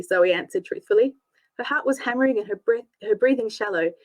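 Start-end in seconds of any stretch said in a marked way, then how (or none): none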